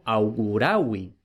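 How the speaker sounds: augurawi stressed on ra, au-gu-RA-wi, said slowly; Latin stress demo. Shown as a demonstration. Teacher showing students the stress in augurawi, slowly. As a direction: lean on ra in augurawi. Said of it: "o auguro, pero".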